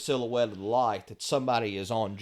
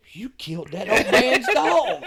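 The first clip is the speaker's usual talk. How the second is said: accusing; 12 semitones higher, 7 dB louder than usual. Retold or imitated. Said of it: "he said".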